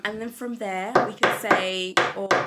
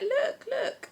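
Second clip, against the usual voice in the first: imitating daughter. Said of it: "She's like".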